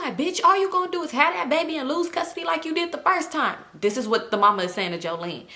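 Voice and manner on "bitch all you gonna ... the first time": high-pitched imitated tirade; mock-angry telling-off, pitched up; scolding mother. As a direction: rattle off the accusation at a raised pitch. Acting out mother's insult.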